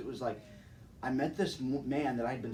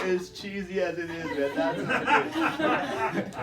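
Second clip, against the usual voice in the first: high, loud laughter through the words; giggling while talking; laughing at own pun; 8 semitones higher, 7 dB louder, slower than usual. Real, not acted. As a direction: crack up at your own cheesy line. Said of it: "dope to hope dealing".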